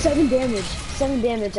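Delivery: loud, bitter complaint; frustrated yell. Real, not acted. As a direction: shout it in disgust. Frustrated at low damage.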